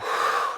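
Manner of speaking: quick voiceless whoosh; demonstrated exhale. Shown as a demonstration. Teacher showing how to let go, audible exhaling sigh. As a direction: drop the breath out in a sigh. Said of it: "and letting go".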